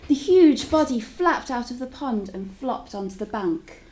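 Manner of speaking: ordinary delivery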